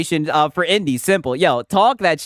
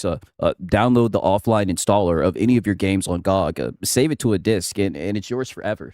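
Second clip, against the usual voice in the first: much lower, put-on deep announcer voice; 11 semitones lower than usual. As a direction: drop into a deep mock-serious voice. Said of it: "I gotta do my deep voice".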